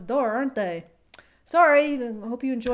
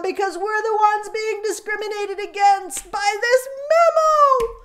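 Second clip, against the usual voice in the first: paranoid hysteria; 6 semitones higher, 6 dB louder than usual. Pretend. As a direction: rant like a hysterical conspiracy crier.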